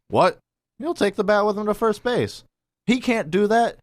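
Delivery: high, incredulous mock outrage; imitating the umpire lady's shock; indignant disbelief. Umpire lady can't believe the ruling, high mock outrage. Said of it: "She's like".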